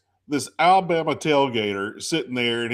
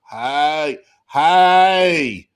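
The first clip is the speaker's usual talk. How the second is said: Southern drawl greeting, louder on the second hey; country accent; 6 dB louder than usual. Retold or imitated. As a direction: impersonate a Southern good ol' boy calling out. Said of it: "the worst country accent I've ever heard".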